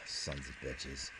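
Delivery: low, slow sighing curse; soft sighed curse, low and slow; muttered curse. Acted. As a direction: drop it under your breath with a sigh. Sighing curse after the joke.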